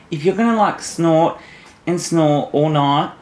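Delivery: ordinary talk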